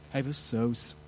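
low, resigned shrug of a voice; resigned cynic. Retold or imitated. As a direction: throw it away like a man who has given up. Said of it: "So resigniert, so zynisch".

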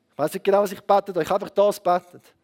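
normal talking voice

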